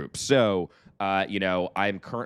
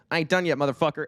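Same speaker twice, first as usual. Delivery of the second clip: higher East Texas punchline; East Texas accent; 7 semitones higher, faster than usual. Retold or imitated. [name]'s punchline in East Texas accent. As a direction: deliver the East Texas kicker with swagger. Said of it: "That's my East Texas accent".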